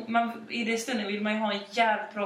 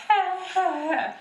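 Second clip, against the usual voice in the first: confused high hä sounds; 10 semitones higher, 4 dB louder than usual. Retold or imitated. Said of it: "Och man bara".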